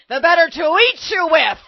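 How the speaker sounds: loud, high menacing wolf outburst; wolf's exclamation. A narrator's reading. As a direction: pounce on the words as a hungry wolf. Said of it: "exclaimed the wolf".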